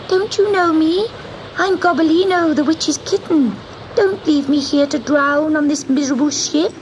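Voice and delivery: a little cat calling up to the witch; kitten crying out. Acted. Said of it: "he cried above the storm".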